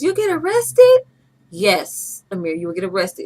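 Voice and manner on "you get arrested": high, loud mock kid voice; a child's high, loud disbelief; child's surprised question. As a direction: ask it like a shocked little boy. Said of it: "Amir goes".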